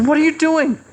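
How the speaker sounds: the reader jumps at the burning paper; alarmed cry. Retold or imitated. Reader shouts at burning newspaper.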